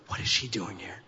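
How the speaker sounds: low, softened mock-disdainful aside; put-on catty undertone; mock disapproval. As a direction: mutter it low, judging her. Acted disapproval of coworker at church.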